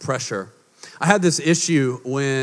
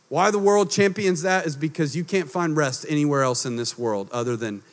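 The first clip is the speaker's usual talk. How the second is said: usual speaking voice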